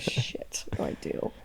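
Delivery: a hushed, voiceless panic; whispered inner freak-out; panicked whisper. Acted. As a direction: whisper it like a flustered aside.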